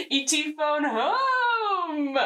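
high, drawn-out E.T. voice; E.T. impression. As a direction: stretch out "home" like a little alien. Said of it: "And then E T goes".

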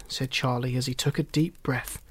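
ordinary delivery, nothing marked

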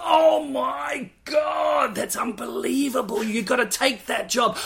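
high, excited squeal of a wife gushing. Retold or imitated. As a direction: squeal it like a starstruck fan. Wife gushing about Safin.

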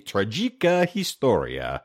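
slow, drawn-out grand immortal voice. Pretend. Host reading an old book's title, in a put-on immortal voice. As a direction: intone it slowly, like an immortal. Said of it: "my immortal voice for that".

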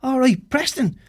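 high-pitched retold holler; imitated shout. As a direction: mimic a man calling from his car. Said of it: "and he's shouting".

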